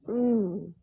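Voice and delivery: loud pleased mmm; savoring mm; appreciative hum. Acted. Host savors clip.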